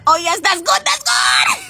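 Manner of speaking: high, loud cheer; cheering shout. Acted. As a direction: celebrate the news. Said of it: "[name], your patois is getting so good".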